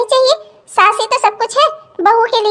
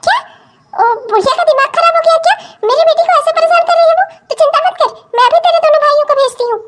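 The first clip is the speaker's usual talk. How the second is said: higher, fast, indignant outburst; outraged mother; 6 semitones higher, much faster than usual. Acted. Mother outraged at her daughter's mother-in-law, fast and indignant. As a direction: flare up in fury at the mother-in-law.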